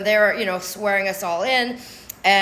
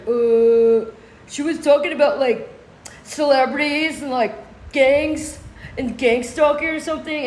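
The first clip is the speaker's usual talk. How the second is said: mock dim-witted man; 3 semitones higher than usual. Accuser vaguely explaining gang stalking to the judge, mock dim-witted. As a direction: mimic someone groping for an answer. Mocking Drake's vague answer.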